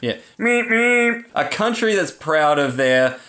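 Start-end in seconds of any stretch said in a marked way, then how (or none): 0.4-1.2 mock horn beep. Mocking a car horn beep.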